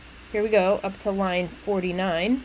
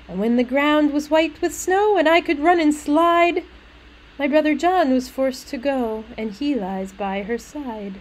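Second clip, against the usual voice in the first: higher lilting verse recital; little maid; 5 semitones higher, 3 dB louder than usual. Acted. Little maid telling of play by the grave, sing-song. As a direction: read it with a child's sing-song bounce. Reads the maid's lines.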